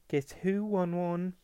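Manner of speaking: put-on high, singsong girlish coo; trilled. A narrator's reading.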